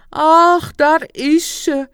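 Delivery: loud, high, slow cry of emotion; drawn-out, overcome exclamation; tearful lament. A narrator's reading. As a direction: lament it at full voice, overwhelmed. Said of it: "veegde zijn tranen weg".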